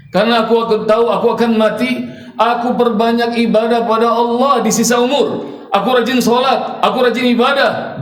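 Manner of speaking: put-on an old man's fear of the grave, pouring out; grieving grandfather, rising to a wail; old man's anguished plea. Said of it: "kata si kakek".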